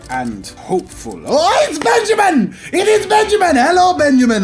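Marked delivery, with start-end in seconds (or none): 1.3-4.4 very high, loud squeal of recognition; over-the-top high-pitched hello in a French accent; excited greeting. Acted. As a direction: shriek the greeting with delight. Calls out greeting to arriving Benjamin.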